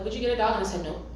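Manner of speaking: ordinary talk, nothing marked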